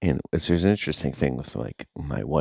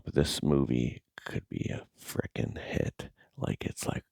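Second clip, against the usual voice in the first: hushed secretive whisper; 3 semitones lower, 6 dB quieter than usual. Real, not acted. Vlogger whispering to viewers about his secret movie idea.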